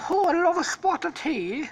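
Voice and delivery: put-on high-pitched caricature of a British boy; mock British boy accent. Stampy the tea lover, high mock British accent. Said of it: "The typical British boy thing".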